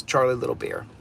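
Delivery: ordinary talk, nothing marked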